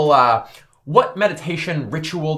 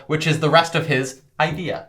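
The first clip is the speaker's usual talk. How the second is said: ordinary talk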